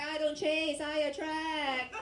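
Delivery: high, drawn-out mock sass; Gen Z liberal. Gen Z soldier answers the general, high drawn-out mock voice. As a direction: sing it out high and self-satisfied. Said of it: "You'll be like".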